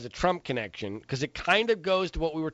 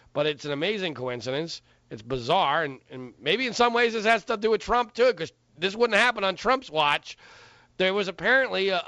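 normal talking voice

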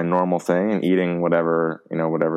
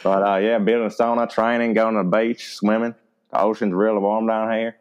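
put-on South Carolina accent; 3 semitones higher than usual. Telling a friend his hobbies, South Carolina accent. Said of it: "in a South Carolina accent".

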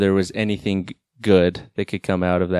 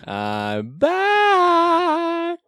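big high proclamation; triumphant announcer. Acted. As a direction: announce your return in a grand voice. Triumphant return.

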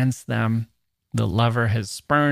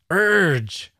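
higher, louder drawn-out urge like a groan; growled urge; 8 semitones higher, 3 dB louder than usual. Shown as a demonstration. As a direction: let the word urge become a groan. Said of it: "Is that where that word comes from".

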